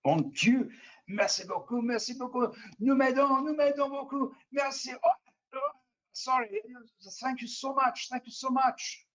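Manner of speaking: put-on high, grateful French Canadian accent; a rescued prisoner thanks his rescuers in a French Canadian accent. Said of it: "French Canadian, uh, voice".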